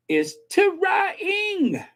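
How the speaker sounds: "trying" shouted high and stretched, tuh-ray-ing; emphatic correction. Shown as a demonstration. Vlogger correcting the pronunciation, shouted high. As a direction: belt "trying" out in three syllables. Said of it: "There's three syllables".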